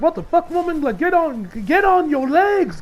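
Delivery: mock freaked-out plea. Real, not acted. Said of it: "even I was kinda freaked out".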